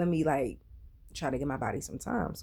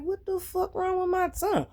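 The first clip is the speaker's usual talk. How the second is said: much higher, alarmed outburst; shocked cry. Retold or imitated. Telling how the noodles burned her tongue, in high alarm. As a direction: squeal it like your tongue just caught fire.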